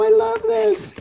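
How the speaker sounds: high-pitched gush of love; delighted squeal. Real, not acted. Quilter finding tools in her gift, high delighted squeal.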